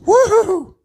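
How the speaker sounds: loud, high cheer of triumph; victorious woohoo; excited whoop. Real, not acted. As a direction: whoop it out like a win. Cheer after getting part out.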